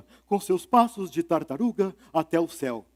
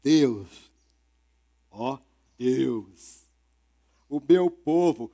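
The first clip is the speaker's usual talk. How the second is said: low, slow plea to God; lightly voiced turtle pleading with God; 7 semitones lower, much slower than usual. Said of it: "a tartaruga disparou a falar".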